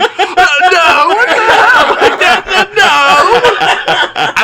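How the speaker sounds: very high, laughing, appalled protest; mock shocked denial. Answering partner asking if podcast buddies said that, mock shocked. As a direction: squeal out a flustered mock denial.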